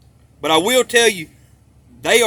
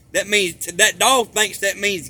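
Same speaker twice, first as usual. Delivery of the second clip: normal talking voice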